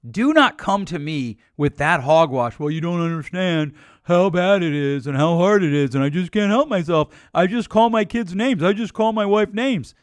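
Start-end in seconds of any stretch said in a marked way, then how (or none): 2.6-9.9 mocking whiny excuse. Mocking excuse-making parents.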